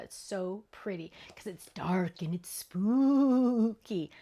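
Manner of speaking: slow breathy spooky whisper. Acted. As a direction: hush it like a ghost story.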